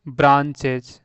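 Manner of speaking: "ब्रांचेज" said firmly, ending on a z sound; pronunciation demo. Shown as a demonstration. Teacher writing out the pronunciation of "ब्रांचेज", emphatic. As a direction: give "ब्रांचेज" a clear final ज. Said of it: "इसका मैं pronunciation लिख देता हूँ".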